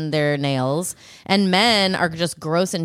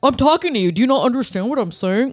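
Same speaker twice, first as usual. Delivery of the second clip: a co-host imitated scolding the dog, in a slightly higher put-on voice; mock scolding owner; 6 semitones higher than usual. Co-host mimicking how someone scolds a dog, lightly put-on scolding voice. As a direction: play the owner lecturing a dog like a person. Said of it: "You're like".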